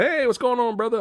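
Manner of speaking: high-pitched chummy hello; friendly greeting. Retold or imitated. Wilder hanging out with the inmates, high friendly greeting. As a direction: greet them like everyone's best buddy. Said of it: "is so friendly and everything".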